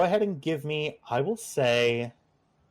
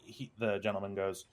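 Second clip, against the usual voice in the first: ordinary delivery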